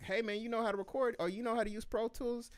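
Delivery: high, eager studio buddy voice; homies asking. Retold or imitated. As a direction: play the excited friend begging for a session. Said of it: "the homies in the studio could be like".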